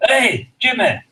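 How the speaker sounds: high, loud call across the street; angry Scotsman call. Retold or imitated. Scotsman calling after the fleeing thief, high loud yell. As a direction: bellow after him like a big angry Scot. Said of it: "he says".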